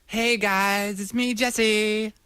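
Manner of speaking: a mincing, sing-song caricature of Jesse; camp Jesse impression. Teasing his co-host with an over-the-top camp Jesse parody.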